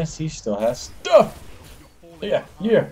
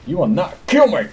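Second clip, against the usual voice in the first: higher, punched "kill me"; emphatic defiance; 4 semitones higher, 4 dB louder than usual. Pretend. Gamer refusing to die in a fight, emphatic defiance. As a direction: defy them, hitting "kill me" hard.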